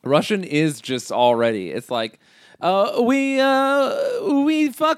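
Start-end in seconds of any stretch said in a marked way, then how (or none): 2.6-5.0 high put-on Borat accent, slow at the end. Friend asks for Russian, she gives a Borat voice. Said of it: "give me Russian".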